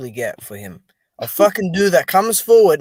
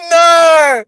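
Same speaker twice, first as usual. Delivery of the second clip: mock cry; 11 semitones higher, 11 dB louder than usual.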